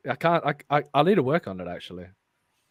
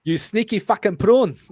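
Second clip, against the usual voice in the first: put-on higher, louder South African accent; District 9 South African accent; 4 semitones higher, 5 dB louder than usual. Reader quoting District 9, South African accent. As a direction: channel a South African film character. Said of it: "channel that guy from District nine".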